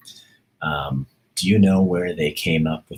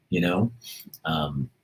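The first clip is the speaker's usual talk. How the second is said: ordinary delivery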